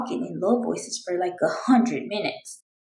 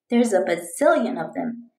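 plain reading voice

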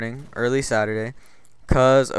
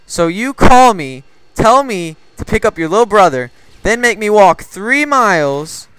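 slow, loud, high recounting of grievances; acted exasperated scolding; 5 semitones higher, 6 dB louder than usual. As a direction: spell out each offence in mock outrage. Retold scolding of friend over errand.

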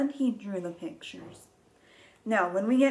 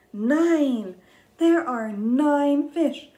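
excited; 6 semitones higher, 6 dB louder, much slower than usual. Shown as a demonstration. Counting climax.

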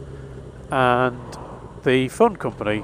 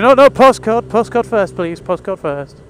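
insistent caller; 7 semitones higher, 4 dB louder, slower than usual. Retold or imitated.

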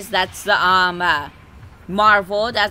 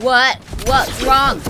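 stunned what; 5 semitones higher, 7 dB louder, slower than usual. Real, not acted. Game suddenly ends.

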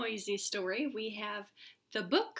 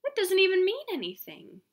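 fast, higher mock protest; mock indignant; 7 semitones higher, 4 dB louder, faster than usual. Reader complaining to kids about the silly word, mock outrage. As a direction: play baffled at the book.

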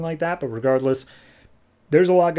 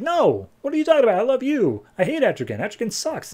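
mock-indignant, much higher voice; protesting denial; 10 semitones higher than usual. Xanadu denies Jason's accusation, protesting in a high voice. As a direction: imitate a flustered lover protesting in a high, rushed voice.

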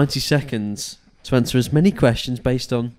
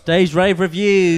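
announcer voice; 6 semitones higher, 3 dB louder, slower than usual. Acted. Announcing show segment.

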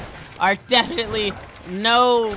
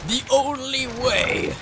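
slow, high, mock-heroic echo; dramatic echo; 5 semitones higher, slower than usual. Shown as a demonstration. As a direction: repeat it like a solemn movie hero.

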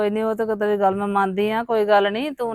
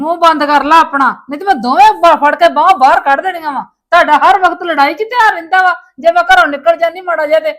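louder and higher, threatening; furious shout; 5 semitones higher, 6 dB louder than usual. Acted. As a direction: yell at them to shut up. Mother threatens to throw them out.

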